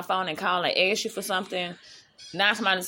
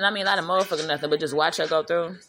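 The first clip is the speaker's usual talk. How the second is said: ordinary talk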